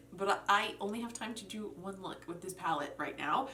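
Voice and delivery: ordinary talk, nothing marked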